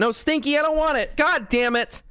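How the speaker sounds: high, exasperated scolding of a pushy pet; annoyed at the cat. Real, not acted. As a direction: shoo the cat away, fed up.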